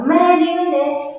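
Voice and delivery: high, drawn-out cry of relief; triumphant, long-stretched release; powerful joy. Retold or imitated. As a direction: savor it slowly, voice lifted with joy. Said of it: "como con un gozo poderoso".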